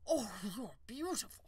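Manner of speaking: lightly voiced farmer, hushed wonder; a hint of a dazzled peasant; awed fat man. Farmer to the unicorn, lightly voiced awe.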